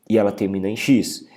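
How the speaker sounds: ordinary delivery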